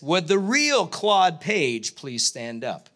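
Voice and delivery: slow, drawn-out host announcement; game show host line. Retold or imitated. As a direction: announce it like a TV host, dragging each word. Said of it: "this is the line that I was looking for".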